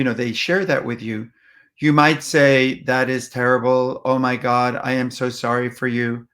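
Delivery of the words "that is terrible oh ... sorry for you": monotone, low-key condolence with no feeling; unfeeling sympathy. Shown as a demonstration. Speaker to grieving friend, detached monotone condolence. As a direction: recite sympathy without meaning it. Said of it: "That's not gonna feel very good".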